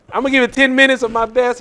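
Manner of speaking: ordinary delivery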